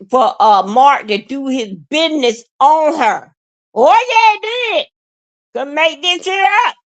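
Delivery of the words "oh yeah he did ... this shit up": high emphatic outburst. Real, not acted. Emphatic affirmation of shocking claim.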